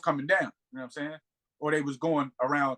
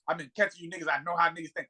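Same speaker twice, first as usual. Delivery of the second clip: ordinary talk, nothing marked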